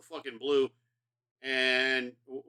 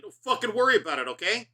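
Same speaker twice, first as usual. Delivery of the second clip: louder, higher mock-defensive retort; playfully defensive, pitched up and loud; defensive snap; 7 semitones higher, 5 dB louder than usual. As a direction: brush them off, hammed-up indignant. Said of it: "I immediately get defensive".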